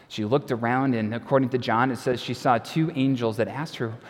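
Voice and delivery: normal talking voice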